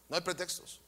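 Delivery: "No hay pretextos" dropped low and very soft; almost breathe "No hay pretextos"; hushed. Real, not acted. Repeated hushed after shouting. (el pastor repite en voz baja y grave "No hay pretextos")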